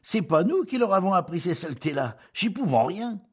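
high, loud peasant voice; villagers' reply. Acted. Priest quotes villagers' reply.

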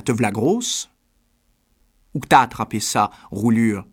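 outraged questions building to a loud insult; angry cry. A narrator's reading. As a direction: cry it out as an accusation. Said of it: "elle cria".

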